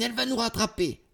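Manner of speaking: fast, very high warning; urgent high-pitched alarm; alarmed cry. A narrator's reading. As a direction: cry out in panic while being chased. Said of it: "crie Valentine".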